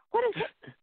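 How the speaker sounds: high mock female voice; wife asking who is calling; imitating wife. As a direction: ask puzzled in her high voice. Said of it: "wife voiced high".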